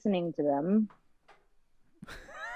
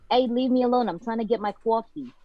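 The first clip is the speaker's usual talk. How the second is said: raised, irritated New York accent; 4 semitones higher than usual. Shown as a demonstration. Guest tries the requested accent, annoyed New York voice. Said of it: "New York accent".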